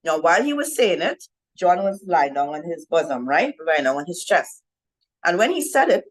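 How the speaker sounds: usual speaking voice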